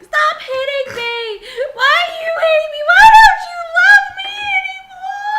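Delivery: shrieking high fake sobs, very loud; fake crying scream. Pranking strangers in Walmart, a loud fake crying shriek. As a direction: wail like a wronged victim to shock shoppers. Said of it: "screaming obscenities".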